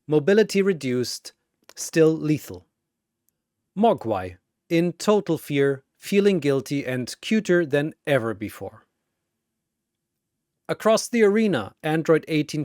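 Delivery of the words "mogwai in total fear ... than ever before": ordinary delivery, nothing marked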